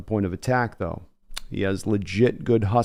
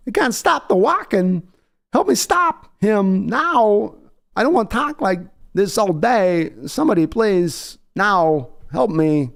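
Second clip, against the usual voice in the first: much higher, halting Walken cadence with odd pauses; Walken impression; 11 semitones higher, 4 dB louder, slower than usual. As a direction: ham up a Walken voice that's stuck and pleading for help. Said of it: "if I tried to do the Walken voice".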